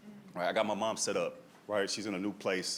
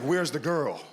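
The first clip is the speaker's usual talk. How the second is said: Liam Neeson menace; 4 dB louder, slower than usual. Retold or imitated. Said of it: "Liam Neeson in her".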